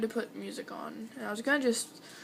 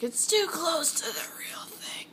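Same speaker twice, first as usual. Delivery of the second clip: whispered grandmother imitation; 6 semitones higher, slower than usual. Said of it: "my grandmother's like".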